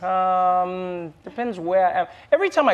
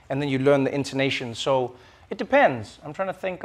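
ordinary talk

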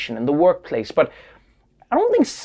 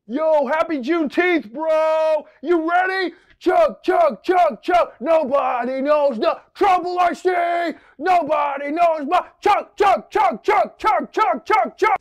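frat bro; 9 semitones higher, slower than usual. Pretend. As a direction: hype up the crowd like a drunk frat guy. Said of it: "some frat boys are gonna be celebrating".

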